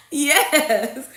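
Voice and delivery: loud, high laughing yes; real laughter in the word. Real, not acted. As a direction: say it through the tail of a big laugh. Still laughing after singing.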